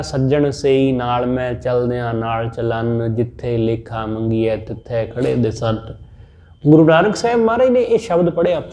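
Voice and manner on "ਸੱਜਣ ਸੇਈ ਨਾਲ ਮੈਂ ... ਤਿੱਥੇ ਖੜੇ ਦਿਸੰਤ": slow, low, soft chanted verse; scripture recitation. Acted. Preacher reciting Guru's verse to the congregation, slow low chant. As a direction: intone the holy line slowly and low. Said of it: "ਸਤਿਗੁਰੂ ਨੇ ਕਿਹਾ".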